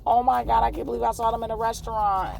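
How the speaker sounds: very high, squealing fangirl voice; hammed-up high-pitched fan frenzy; starstruck fans. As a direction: gush like a fan after meeting a celebrity. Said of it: "people gonna be like".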